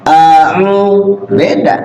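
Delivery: A'u with a throaty ain, stretched slowly; clear ain. Shown as a demonstration. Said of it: "itu ain". (ain ditekan dari tenggorokan)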